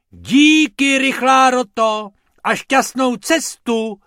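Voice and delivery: very loud, high shouted farewell; shouting thanks. Acted. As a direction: yell thanks after the departing rabbits. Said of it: "křikl pan Pumpernagel".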